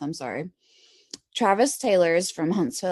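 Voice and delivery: ordinary talk, nothing marked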